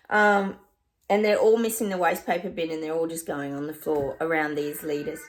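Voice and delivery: ordinary talk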